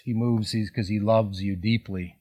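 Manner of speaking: ordinary delivery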